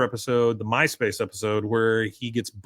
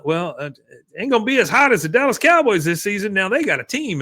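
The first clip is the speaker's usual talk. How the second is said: quick, higher-pitched salesman chatter; put-on chummy small talk, pitched up; hearty sales banter; 7 semitones higher, 4 dB louder, faster than usual. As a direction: imitate an eager salesman's patter.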